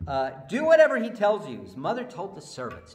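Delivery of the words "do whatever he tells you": loud command. Acted. Loud take of Mary's line.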